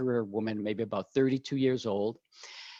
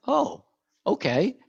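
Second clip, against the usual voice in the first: surprised; 7 semitones higher, 4 dB louder, slower than usual. Retold or imitated. Surprised reaction to flute answer.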